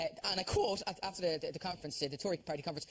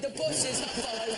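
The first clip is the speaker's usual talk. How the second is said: slow drawn-out warning; 4 semitones higher, 3 dB louder, slower than usual. Pretend. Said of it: "in Northern Irish fans".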